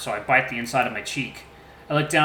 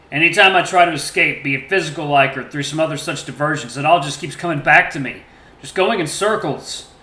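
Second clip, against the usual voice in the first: hopeless despair; 3 semitones higher, 7 dB louder than usual. A narrator's reading. Father despairing, gun in hand.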